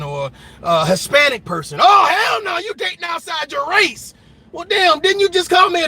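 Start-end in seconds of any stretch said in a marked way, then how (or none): 1.8-4.1 mock outraged partner. Imitating a partner scolding about dating outside the race. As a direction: play the offended partner, hammed up.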